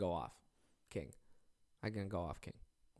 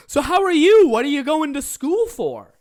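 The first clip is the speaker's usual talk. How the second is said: much higher, louder, slow overly nice questions; mock friendly Jacob; 17 dB louder than usual. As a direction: ham up a super polite guy making small talk.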